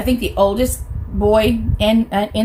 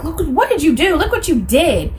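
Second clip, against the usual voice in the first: higher, accusing mock scold; imitating an accusing mother; 7 semitones higher than usual. Mother blaming the little girl who fired, mock accusing. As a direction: jab the blame at the kid, pitched up. Mother blames child after shooting.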